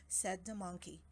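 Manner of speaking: ordinary delivery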